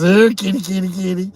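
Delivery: high, loud kitty call; lightly mocked cat-calling voice. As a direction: sing out to the cat like calling it home.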